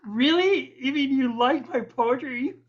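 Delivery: high-pitched mock amazement; acted incredulity. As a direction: play delighted disbelief on the phone. Retelling his disbelief on the phone.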